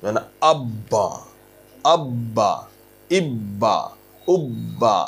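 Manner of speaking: আব্বা with a firm push on the doubled ব্ব; doubled consonant push. Shown as a demonstration. Quran teacher demonstrating a forceful doubled consonant to students. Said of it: "এক প্রকার জোর ধাক্কা দিতে হবে আপনাকে".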